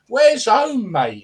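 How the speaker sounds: indignant jeer. Real, not acted. Mocking banner-carrying fans.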